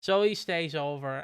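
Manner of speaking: ordinary talk, nothing marked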